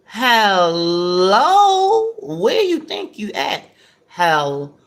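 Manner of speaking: incredulous, drawn-out hellos swooping up; throwing shade. Real, not acted. Throwing shade at yesterday's comment, sassy and incredulous. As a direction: call them out with mock disbelief. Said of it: "I'm gonna throw some shade".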